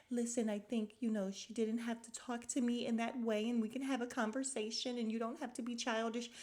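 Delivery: mock-professional, lifted pitch; code-switching. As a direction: imitate a carefully polite confessional voice. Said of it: "she's able to do the code switching".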